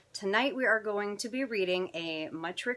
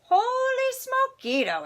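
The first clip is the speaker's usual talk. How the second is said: very high, drawn-out astonishment; amazed exclamation; 7 dB louder, slower than usual. Acted. Skippy seeing the doggy in the mirror, amazed exclamation. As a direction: stretch it out high, hammed-up amazement. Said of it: "exclaimed Skippy John Jones".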